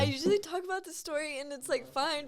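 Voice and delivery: high-pitched tearful bewilderment; really crying. Real, not acted. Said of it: "why am I crying".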